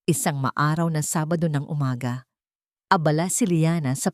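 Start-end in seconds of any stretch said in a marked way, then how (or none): none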